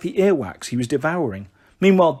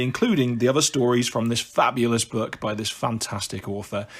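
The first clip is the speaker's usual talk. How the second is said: usual speaking voice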